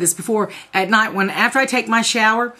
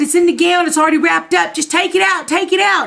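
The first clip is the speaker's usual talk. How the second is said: frantic urging; 8 semitones higher than usual. Retold or imitated.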